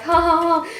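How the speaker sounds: high, forced polite laugh; fake laugh. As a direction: fake a laugh to play along. Awkward fake laugh, retold.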